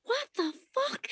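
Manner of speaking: high, outraged demand; furious confrontation. Retold or imitated. Stripper yelling at a customer who grabbed her head. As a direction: let the indignation burst out.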